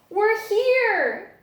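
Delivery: high, loud, thrilled cry; joyful arrival. A narrator's reading. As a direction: burst out with joy at arriving. Bats arrive at the library.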